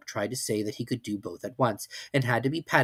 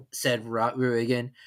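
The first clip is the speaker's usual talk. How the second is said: ordinary delivery, nothing marked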